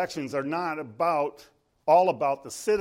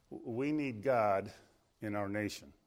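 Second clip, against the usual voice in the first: ordinary delivery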